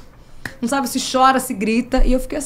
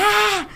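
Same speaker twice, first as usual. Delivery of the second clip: retold scream; 13 semitones higher than usual. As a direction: shriek "Ah!" up high like on a roller coaster. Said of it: "Eu comecei a gritar". (grito agudo de montanha-russa)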